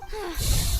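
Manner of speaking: a heavy sigh pushed out loud; loud weary sigh. Acted. Guide sighing at the curse talk, heavy sigh.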